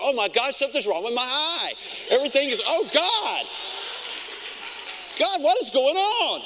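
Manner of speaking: rising, alarmed cry of panic; mock panic. Preacher retelling his eye drop mishap, mock panic. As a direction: act out the sudden fright over the burning eye.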